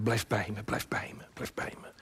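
fading into a whisper; whispered plea. Acted. Preacher as Jesus asking sleeping disciples to stay, whispered.

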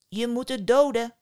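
emphatic, pushed instruction; forceful command. A narrator's reading. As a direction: press her hard to act. Sister urges killing the monster.

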